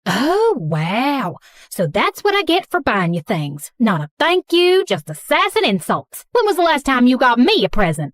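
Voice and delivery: affronted Southern mom; thick Southern accent. Acted. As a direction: scold an ungrateful daughter in Southern twang. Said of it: "speaking in a thick Southern accent".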